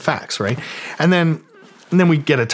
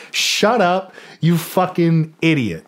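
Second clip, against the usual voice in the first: retold snapping; 5 semitones higher, slower than usual. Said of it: "my lawyer is like".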